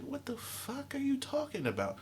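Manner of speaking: high, very soft mock retort; imitating his younger self, pitched high and hushed; mock kid retort. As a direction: mutter it like a sassy kid. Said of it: "I'm like".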